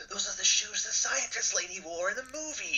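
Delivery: high, drawn-out gush of recognition; gasp, then coo it in a high voice; gushing shopper. Acted. Imagined shopper spotting movie shoes in a mall window, mock gushing. Voicing an awed future shopper.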